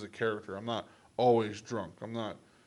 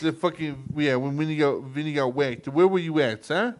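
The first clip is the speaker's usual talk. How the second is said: loud, raised mock New York accent; 3 semitones higher, 9 dB louder than usual. Mobster demanding an alibi for Vinny's killing, loud New York accent. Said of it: "trying to do New York accent".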